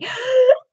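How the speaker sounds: sharp high gasp of surprise; mock shocked gasp. She gasps at her co-host stepping onto the beach. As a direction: gasp as if seeing a loved one appear. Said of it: "This would be me".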